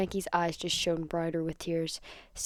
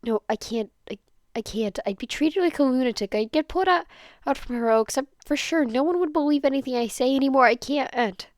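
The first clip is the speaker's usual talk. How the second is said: a high, pressed, insistent refusal; emphatic refusal; 6 semitones higher, 5 dB louder than usual. Acted. Denki emphatically refusing Hitoshi's plan to tell an adult, voice pitched up. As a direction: push back hard, voice rising. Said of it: "He emphasizes".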